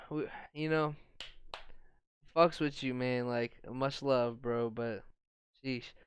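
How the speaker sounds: ordinary talk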